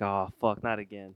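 low, very quiet retold groan of doubt; dejected self-quote. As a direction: mutter it low, as if hopes are sinking. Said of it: "I was like".

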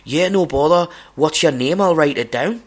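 put-on broad Scottish accent. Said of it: "the guy who's hosting it goes".

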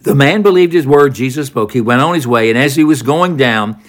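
normal talking voice

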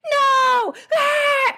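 very high imitated screaming tantrum; freaking-out toddler. Small son refusing his mother's sandwich, high mock tantrum scream. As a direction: squeal a refusal like a small child. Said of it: "where he's freaking out".

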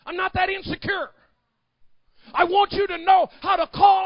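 rising to a high, loud shout; full-force preaching. Acted. Preacher urging them to call out to Daddy, full-force shout. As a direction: build to a thundering pulpit cry.

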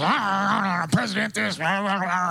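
lightly voiced angry radio ranting; mock ranting host. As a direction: mimic a ranter droning on. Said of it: "they're like".

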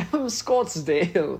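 slow laughing echo in a mock Scottsdale accent. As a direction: copy the host's fake local drawl while laughing. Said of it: "the Scottsdale accent".

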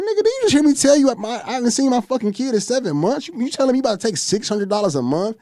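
indignant protest. Retold or imitated. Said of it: "I said".

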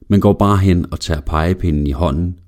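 plain reading voice

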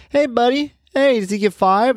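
high-pitched imitation of chummy baby talk; greeting a child. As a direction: mimic someone coaxing a little kid for a five. Voicing people greeting his small child.

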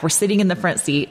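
normal talking voice

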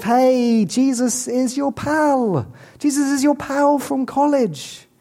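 high, slow, chummy pal-from-college voice; breezy buddy Jesus. Retold or imitated. Preacher mocking a casual Jesus for the congregation, chummy high voice. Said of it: "As if".

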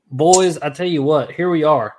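normal talking voice

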